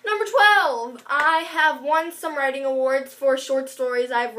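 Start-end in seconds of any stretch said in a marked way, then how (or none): 0.1-1.0 higher and louder announcing. Shown as a demonstration. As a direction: announce it boldly. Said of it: "right? Twelve?".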